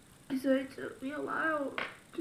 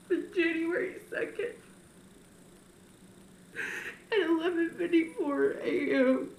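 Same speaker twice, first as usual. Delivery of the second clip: crying; 4 semitones higher than usual. Real, not acted. Telling viewers of her grandmother's death, crying. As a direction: voice breaking through tears.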